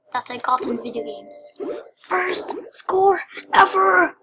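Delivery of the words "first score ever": high, drawn-out cry of triumph; triumphant cheer. Real, not acted. Player boasting of a first score, triumphant. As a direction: stretch each word in high celebration.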